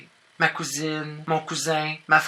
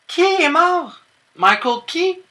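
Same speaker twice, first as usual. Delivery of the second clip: very high, loud disbelief; shocked outcry; 8 dB louder, slower than usual. Acted. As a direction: cry out as if scandalised. Mock shock at a name. (cri aigu, incrédule)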